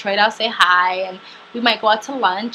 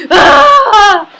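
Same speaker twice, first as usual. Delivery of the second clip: imitated sobbing wail; 12 semitones higher, 13 dB louder than usual. Telling of crying on the concrete, imitated sobbing wail. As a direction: gasp into a loud, high wail. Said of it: "crying and crying and crying".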